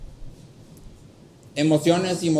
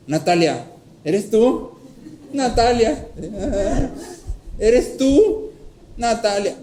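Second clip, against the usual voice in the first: frightened calling out; 6 semitones higher, slower than usual. Retold or imitated. Child calling to the figure in the doorway, higher and more scared. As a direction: call out to the dark doorway, growing more frightened. Calling out to unknown crying girl.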